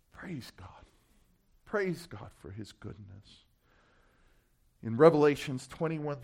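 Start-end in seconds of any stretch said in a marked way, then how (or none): 0.1-3.5 near-whisper, then soft and low; hushed praise. Real, not acted. Preacher praising God after the promise, hushed and low. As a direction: breathe out thanks, barely voiced at first.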